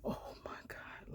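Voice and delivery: barely audible hushed oh my God; whispered aside. Retold or imitated. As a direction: mouth it under your breath, overcome. Retold reaction at tearful goodbye.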